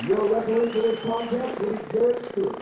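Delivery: booming, drawn-out ring announcer call. Acted. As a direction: build to a roaring name call. Ring announcer introduces fighter.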